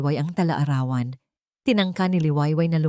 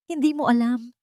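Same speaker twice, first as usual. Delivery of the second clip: higher-pitched disbelieving cry, little added volume; mild cry of outrage, pitched up; understated shout; 7 semitones higher than usual. A narrator's reading. Liwayway confronts Dante about his denial, understated shout. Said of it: "Sigaw ni Liwayway".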